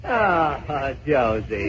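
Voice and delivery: soft, slow, hammed-up lover's sigh; mock tender. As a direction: draw out the beloved's name softly. Mock lovers' reunion in sketch.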